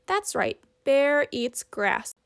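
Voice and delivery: plain reading voice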